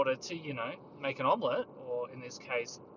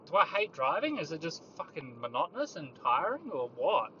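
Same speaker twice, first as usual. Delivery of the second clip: usual speaking voice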